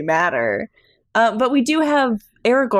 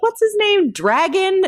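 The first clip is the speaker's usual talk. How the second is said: confused; 11 semitones higher, slower than usual. Retold or imitated. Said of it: "I keep being like".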